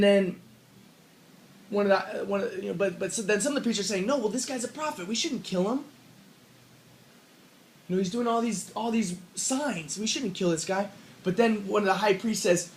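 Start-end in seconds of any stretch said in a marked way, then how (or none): 4.1-10.9 higher-pitched, lightly acted objection; mild protest. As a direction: plead their case as retold priests. Retells priests objecting to killing.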